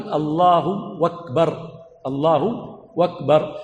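"أكبر" begun with w, as "وَكبر"; "Allahu wakbar", with no hamza in "أكبر"; waw replacing hamza. Shown as a demonstration. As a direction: swap the glottal stop of "أكبر" for a w sound. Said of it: "الهمزة ويأتي بالواو".

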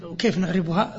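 usual speaking voice

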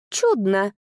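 usual speaking voice

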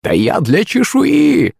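high, very slow gleeful hag; throaty crone glee. A narrator's reading. Crone gloating over hydra scales, throatily gleeful. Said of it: "гортанно засмеялась Ядвига".

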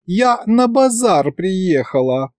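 slow, high pouting whine; petulant drawn-out protest; capricious drawl. A narrator's reading. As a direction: drag it out like a sulky girl. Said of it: "капризно протянула девушка".

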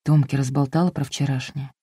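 soft, hushed tragic whisper; a hint of tragic hushed worry. A narrator's reading. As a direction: whisper it as if all is lost. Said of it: "трагическим шёпотом спросил".

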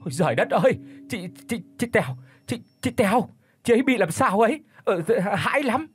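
high, panicky stammer, words tumbling; stammering in fright. Acted. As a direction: stutter it out in horror, voice up high. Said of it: "miệng thì lắp bắp không có thành tiếng".

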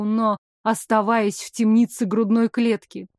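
usual speaking voice

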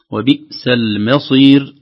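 loud, slow "وبئس المصير" with thin م; light mim. Shown as a demonstration. Teacher models the last caution, thinned م. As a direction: keep the م light before ص in "المصير". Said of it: "المرققة قبل الصاد".

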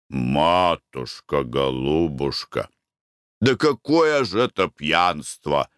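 low, very slow placating; coaxing persuasion. A narrator's reading. Husband tries to convince his angry wife, coaxing. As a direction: soothe her with slow endearments, pitched low. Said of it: "старался убедить её муж".

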